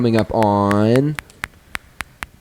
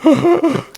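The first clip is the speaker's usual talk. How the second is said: imitated gasp. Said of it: "And he goes, he goes".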